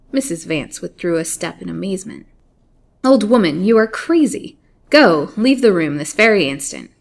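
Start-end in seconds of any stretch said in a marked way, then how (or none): 3.0-6.9 loud, indignant command to leave; indignant order. Acted. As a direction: throw the old woman out with your voice. Lady orders the hag out.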